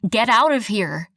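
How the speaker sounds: loud shout to scare; yelling. Retold or imitated. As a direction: shout it out, arms waving. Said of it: "I waved my arms again for effect".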